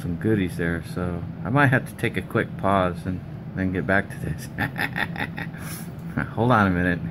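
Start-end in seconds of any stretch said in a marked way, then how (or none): 4.5-6.3 soft breathy chuckle; quiet laugh. Real, not acted. Wife brings shortcake mid-repair.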